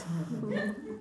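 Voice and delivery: a short breathy chuckle at the joke; soft laugh. Real, not acted. Storyteller laughing softly at the punchline. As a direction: laugh quietly at her own story.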